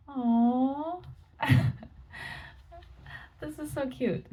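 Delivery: high, soft, delighted coo; cooing aww. Real, not acted. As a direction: melt over something adorable. Reacts to cute card.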